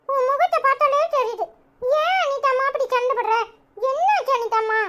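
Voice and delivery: put-on a small child's high, chirpy voice, puzzled and coaxing; high little-girl voice. Little girl asking Anitha why she looks sulky, high child voice. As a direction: chirp like a curious little girl checking on the family. Young relative voiced by same actor.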